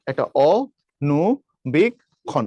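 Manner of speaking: অ, নু, বিক, খন with a short pause between; syllable by syllable. Shown as a demonstration. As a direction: count out four separate syllables অ, নু, বিক, খন. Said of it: "এই চারটা".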